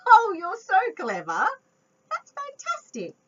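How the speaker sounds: laughing, high, loud praise; delighted praise. Real, not acted.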